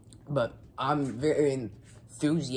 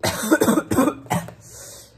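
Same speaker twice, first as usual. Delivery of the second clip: harsh coughing fit; 12 semitones higher, 9 dB louder than usual. Real, not acted. Eating extremely spicy food.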